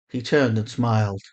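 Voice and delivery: plain reading voice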